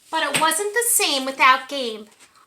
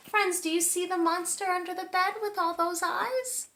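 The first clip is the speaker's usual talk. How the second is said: ordinary delivery, nothing marked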